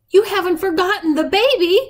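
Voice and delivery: high, urgent question; alarmed disbelief. A narrator's reading. Girl to forgetful Granny about the baby, alarmed disbelief. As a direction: press her in alarm.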